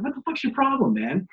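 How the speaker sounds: high-pitched mock yell; retold yelling back. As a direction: imitate an indignant comeback, pitch jumping then dropping. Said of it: "he starts yelling at him back".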